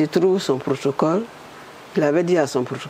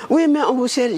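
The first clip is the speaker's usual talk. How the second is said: higher insistent caller voice; insisting caller; 8 semitones higher than usual. Retold or imitated. Woman retelling the caller insisting, higher voice. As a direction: press her, lightly voiced.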